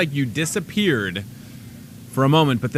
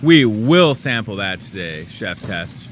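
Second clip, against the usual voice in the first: slow, lower, falling like a campaign pledge; politician delivery; 4 semitones lower, 3 dB louder, slower than usual. Shown as a demonstration. Host hamming up a politician promising a sample. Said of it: "That's my politician hand".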